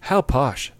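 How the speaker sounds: slow, playful French accent. Shown as a demonstration. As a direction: put on a fancy French accent. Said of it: "I'll do a French accent".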